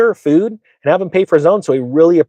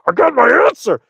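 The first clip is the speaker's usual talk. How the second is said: very high, strained excited squeal; bursting eagerness; 11 semitones higher than usual. Retold or imitated. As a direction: act out barely holding the answer in. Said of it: "I'm being quiet and I'm ready".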